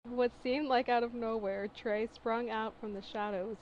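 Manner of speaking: usual speaking voice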